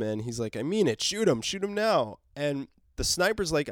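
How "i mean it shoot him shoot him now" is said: retold yell. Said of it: "Dwight's girl yells at him".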